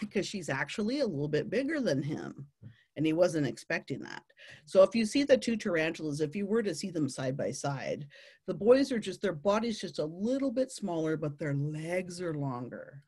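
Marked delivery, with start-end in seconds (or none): none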